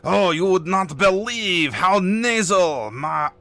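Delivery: loud, slow, haughty cartoon French accent; outrageous French accent. Telling the viewers about a game, in a cartoonish French accent. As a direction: lay on a hammy, nasal French accent. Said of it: "with an outrageous French accent".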